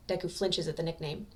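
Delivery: plain reading voice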